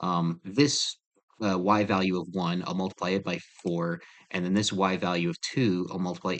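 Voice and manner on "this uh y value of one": ordinary talk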